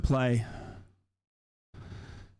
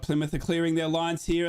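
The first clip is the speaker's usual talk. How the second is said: normal talking voice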